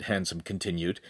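usual speaking voice

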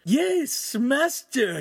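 very high, eager agreement; keen apprentice, pitch leaping up; enthusiastic. A narrator's reading. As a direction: agree with delight to your master. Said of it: "Ugolino agreed enthusiastically".

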